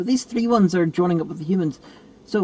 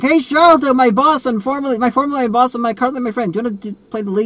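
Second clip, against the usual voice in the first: eager director pitching; 6 semitones higher, 4 dB louder than usual. Retold or imitated. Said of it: "he was just like".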